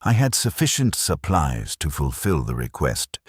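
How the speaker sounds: plain reading voice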